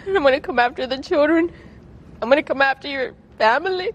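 high, tearful voice that keeps breaking; reliving his threats through real tears; real crying. Real, not acted. Wife quoting her husband's threats to the interviewer, crying.

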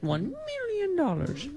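high, slow, amazed marvel at million; hammed-up wonder at the ad's big number; mock awe. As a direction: savor the sum like a game-show host.

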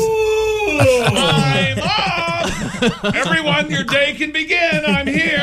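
high, hammed-up triumphant yell; mock morning scream. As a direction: announce you're awake to the whole house. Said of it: "he basically screams".

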